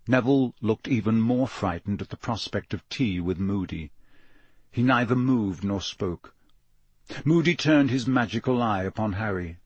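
ordinary delivery, nothing marked

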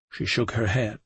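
usual speaking voice

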